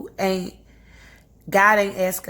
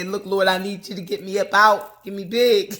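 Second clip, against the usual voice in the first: bold prayer; 5 dB louder than usual. Shown as a demonstration. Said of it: "I suggest you say".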